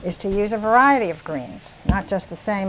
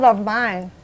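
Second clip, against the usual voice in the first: ordinary talk, nothing marked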